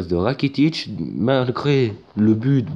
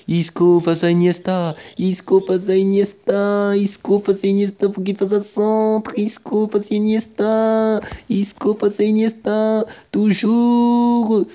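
higher and higher with every repeat; rising commentator excitement; 7 semitones higher than usual. Acted. Commentating Isco against Iniesta, excitement building higher. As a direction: build the tension each time.

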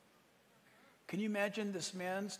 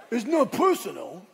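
mock hit man; 3 semitones higher, 10 dB louder than usual. The hit man tells his victim, mock gangster laughing. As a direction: deliver the line like a movie gangster, amused. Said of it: "it was like Guido".

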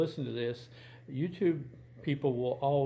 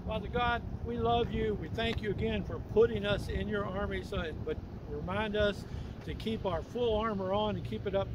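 higher, earnest closing prayer; 8 semitones higher than usual. Real, not acted. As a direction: pray to God for the listeners.

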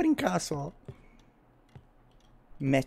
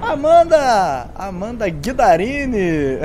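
calling out; 5 semitones higher, 9 dB louder, much slower than usual. Real, not acted. Calling a chat member loudly.

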